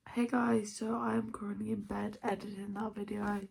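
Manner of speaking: talking with a retainer in; wearing a retainer. Real, not acted. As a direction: retainer in, late-night quiet. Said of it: "I have my retainer in".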